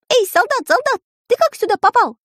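very high calling siskin; high bird voice. A narrator's reading. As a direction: call down like a small bird. Siskin calls to soldier.

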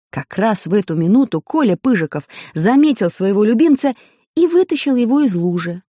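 ordinary delivery, nothing marked

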